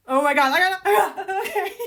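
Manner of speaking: high squealing, laughing overwhelm; loud gush climbing into a teary squeal; real giddy meltdown, barely getting words out; too excited. Real, not acted. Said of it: "I'm getting too excited".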